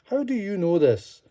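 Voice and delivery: a touch of suspicion; suspicious. Acted. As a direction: question him with distrust. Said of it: "one man said suspiciously".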